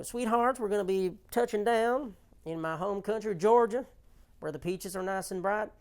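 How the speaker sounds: sugary Georgia belle on the intercom; Southern lady pilot. Pretend. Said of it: "if she has a southern accent".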